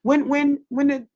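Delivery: high, repeated false starts; tongue-tied with excitement; excited stuttering. Real, not acted. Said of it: "'cause I'm a, I'm a stutter".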